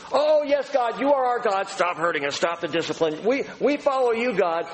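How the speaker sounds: high, pleading mock prayer; hammed-up pleading voice, lifted high; mock pleading people. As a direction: whine it like someone begging to be let off. Voicing the people's empty pleas.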